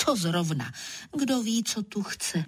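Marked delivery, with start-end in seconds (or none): none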